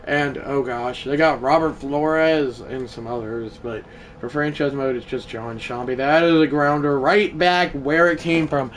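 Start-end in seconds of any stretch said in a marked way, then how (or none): none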